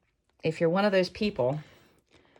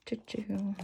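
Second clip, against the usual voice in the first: whisper; 2 semitones higher, 11 dB quieter than usual. Acted. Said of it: "starting another inner-voice quote".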